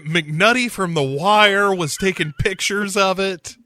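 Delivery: ordinary talk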